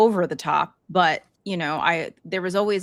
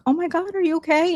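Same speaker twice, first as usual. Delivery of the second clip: high, louder startled check on a friend; retelling a worried outburst, pitched way up; alarmed concern; 12 semitones higher, 5 dB louder than usual. Retold or imitated. Host retells greeting her crying friend at the door, alarmed concern.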